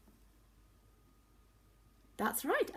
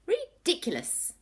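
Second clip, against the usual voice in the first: high, loud indignant cry; cried out; 12 semitones higher, 8 dB louder than usual. A narrator's reading. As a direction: scoff it out loud. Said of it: "cried Mister Tortoise".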